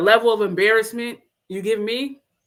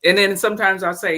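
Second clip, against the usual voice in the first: usual speaking voice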